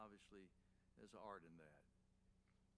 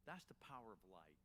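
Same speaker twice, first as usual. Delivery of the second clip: usual speaking voice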